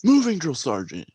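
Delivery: obedient recruit. Retold or imitated. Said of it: "I'm like".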